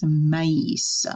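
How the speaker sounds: "Maïs" in two syllables, ma-eess; "Maïs" as if a dash split A and I; two separate vowels. Shown as a demonstration. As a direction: model "Maïs" with A and I apart, s sounded. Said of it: "makes it two different sounds".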